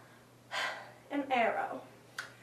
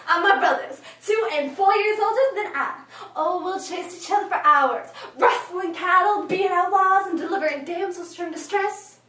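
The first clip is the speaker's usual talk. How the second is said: put-on loud, boastful eight-year-old; excited tomboy; 5 semitones higher, 7 dB louder than usual. Eight-year-old showing off a cowboy outfit, excited. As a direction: rattle off the outfit with big, bouncy pride.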